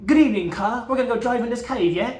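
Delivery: hammed-up British teen inviting friends; mock British accent. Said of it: "so it's more like".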